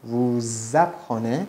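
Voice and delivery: "Vous apprenez" slowly, with a held z linking the words; liaison Z. Shown as a demonstration. Teacher showing the z students hear, stretched liaison in "Vous apprenez". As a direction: draw out the z between "Vous" and "apprenez".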